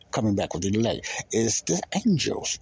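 normal talking voice

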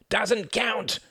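put-on high-pitched boyish scream of objection; screamed protest. A narrator's reading. Reginald objects to his brother's win, high scream. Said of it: "screamed Reginald".

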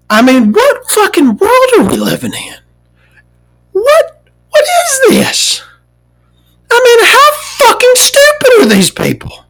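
shoots way up high, near shrieking in disbelief; ranting pitch climbing into a strangled cry; exasperated rage. Real, not acted. Venting to viewers about the clerk, strangled high exasperation. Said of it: "I've got a lot of rage today".

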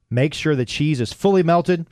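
usual speaking voice

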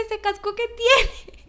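very high, slow squeal of disbelief; incredulous outburst. Retold or imitated. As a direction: squeak the question out, outraged by the price. Cuestiona el precio, atónita. (chillido de incredulidad)

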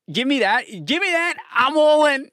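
rising into a loud, high shout; fan frenzy. Real, not acted. Podcaster hyped over his trade, shouting he is all in. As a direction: build from a demand to full commitment.

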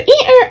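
high squeaky rubbing noise, eh ah; balloon squeak imitation. Sister rubbing a balloon, imitated squeaky noise. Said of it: "my little sister did the".

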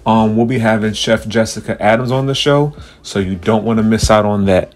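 big booming show host voice; announcer. Pretend. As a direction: play the grand host welcoming fans. Grand mock podcast host intro.

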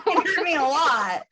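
laughing through mock hurt; giggling while complaining. Real, not acted.

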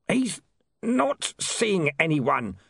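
high, halting squeak under pressure; pained, muffled yelp. A narrator's reading. Pinned clerk refuses the visitor, pained and strained. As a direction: force it out slowly, pitched high. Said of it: "The words ended in a muffled yelp".

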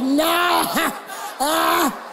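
hammed-up squeal with a laugh; mock recoil. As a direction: imitate people recoiling in disgust. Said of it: "some of y'all like".

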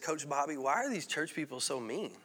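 put-on low, hushed retelling of a tearful boy; retold crying. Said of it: "And he's crying".

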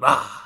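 loud sudden "Ah!" to startle; jump scare. Retold or imitated. Boyfriend scaring her mid-conversation, a loud "Ah!". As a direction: yell it out of nowhere to spook her. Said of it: "he'll be like".

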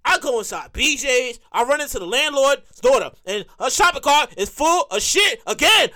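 usual speaking voice

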